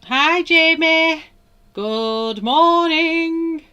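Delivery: very high, loud and slow hello; drawn-out cheery hello to a viewer; sing-song greeting. Real, not acted. Greets a live chat viewer.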